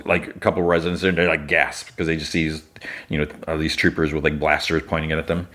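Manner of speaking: ordinary delivery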